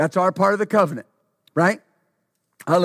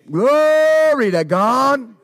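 drawn out, loud and high exclamation; stretched, ringing shout of praise; exultant praise; 4 semitones higher, 5 dB louder, much slower than usual. Real, not acted. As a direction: cry out glory to God slowly.

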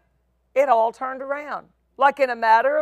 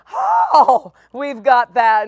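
[name] impression; 5 dB louder, slower than usual. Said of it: "that's my best [name] impression".

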